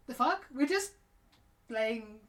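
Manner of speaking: mock bewildered protest, on the verge of laughing; imitated gobsmacked reaction. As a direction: act out their stunned disbelief.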